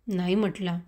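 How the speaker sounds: ordinary delivery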